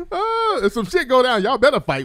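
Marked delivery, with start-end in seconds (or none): 0.1-0.6 cracking up into a high laugh; helpless high laughter; high laughing fit. Real, not acted. Host laughing hard at the Charlie's Angels idea, high laughter.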